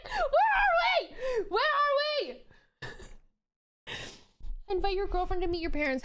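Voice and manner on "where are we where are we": high, loud laughing yell, stretched slow; laughing shout. Real, not acted. Streamer laughing at the story, high shrieking yell.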